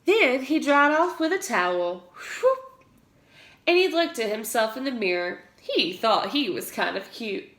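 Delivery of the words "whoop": high, loud whoop; a quick high whoop; whoop sound. A narrator's reading. Narrator making the towel sound for kids.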